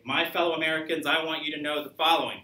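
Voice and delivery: loud, high campaign-speech proclamation; political candidate. Shown as a demonstration. Lecturer plays candidate addressing fellow Americans, loud oratory. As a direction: make a strong point like someone running for office. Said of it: "if you're running for office".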